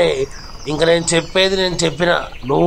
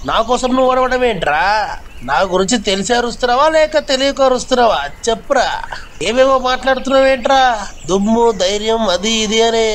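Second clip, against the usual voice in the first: higher-pitched, mocking interrogation; menacing hero; 3 semitones higher than usual. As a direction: grill the loudmouth like a bully who owns the place. Hero confronts the loud zombie.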